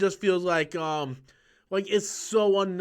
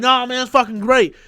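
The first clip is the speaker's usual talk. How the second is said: louder and higher fired-up insistence; retold insistent shout; 6 semitones higher, 9 dB louder than usual. Arguing with friend about the movie, loud insistent. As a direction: shout it back at your friend. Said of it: "I'm like".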